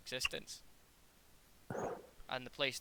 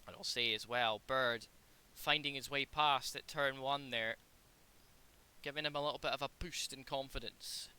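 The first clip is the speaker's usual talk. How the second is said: motorsport commentator; 7 dB louder than usual. Acted. Sim race play-by-play.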